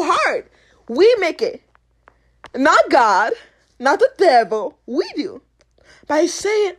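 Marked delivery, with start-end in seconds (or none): none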